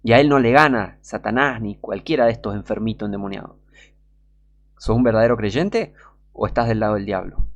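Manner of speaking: ordinary talk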